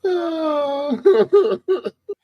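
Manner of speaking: high-pitched wail breaking into chuckles; a long high "ooh", then laughing; high groaning laugh. Real, not acted. Co-host reacts to the prediction G Money will win, high groaning laugh.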